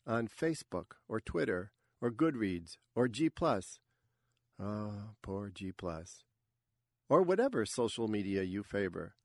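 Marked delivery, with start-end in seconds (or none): 4.6-6.3 mock pity. Real, not acted. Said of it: "host's mock pity".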